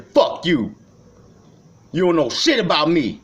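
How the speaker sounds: lashing out, voice high and loud; angry rant. Real, not acted. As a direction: pitch climbing into a furious yell. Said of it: "I'm going off and melting down".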